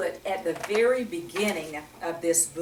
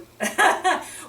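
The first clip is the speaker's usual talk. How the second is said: quick high laugh; real amused chuckle; 10 semitones higher, 4 dB louder than usual. Real, not acted. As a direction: laugh at the joke. Laughing at teacher's no.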